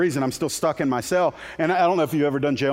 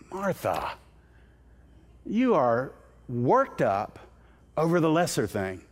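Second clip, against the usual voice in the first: gentle rebuke; 3 semitones lower, 3 dB quieter, slower than usual. Acted. Jesus gently corrects Martha.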